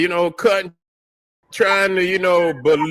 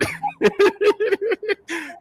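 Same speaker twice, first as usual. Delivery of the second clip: drawn-out high cackle; real laughter, squealing; long high laugh. Real, not acted. Laughing at own joke.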